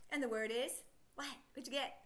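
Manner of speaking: ordinary talk, nothing marked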